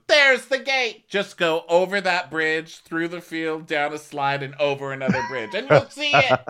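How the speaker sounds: high, loud mocking directions; Jenny sarcastically pointing Mary to the exit, high and mocking; sarcastic directions. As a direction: send her off sarcastically. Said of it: "she goes".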